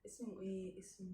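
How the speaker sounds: whispering. Real, not acted. Trying to recall name.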